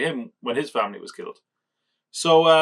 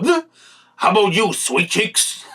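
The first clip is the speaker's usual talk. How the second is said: higher, louder hammed-up villain taunt; jump-scare villain; 8 semitones higher, 4 dB louder than usual. Villain lunging at the kid's face, hammed-up taunt. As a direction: pop out with a mock villain leer. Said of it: "thought it might be a jump scare".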